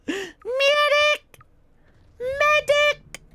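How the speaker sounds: high desperate call for a medic; man down shout. Acted. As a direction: scream for the medic. Downed in game, calling medic.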